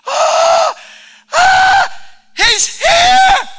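very high, loud ecstatic screams; crowd's ecstatic shout. Retold or imitated. Preacher voicing the Jerusalem crowd, ecstatic screaming. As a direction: scream like the king just arrived. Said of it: "people are freaking out".

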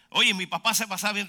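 ordinary talk, nothing marked

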